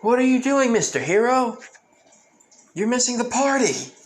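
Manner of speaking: high, loud cheerful call; yelling out. Retold or imitated. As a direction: shout it across to him. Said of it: "Izuku yelling out saying".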